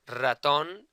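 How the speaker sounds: Ratón stressed on the final TÓN, loud; Ratón with the weight on its accented last syllable. Shown as a demonstration. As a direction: say Ratón clearly, leaning on the second syllable. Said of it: "where the emphasis of the word is".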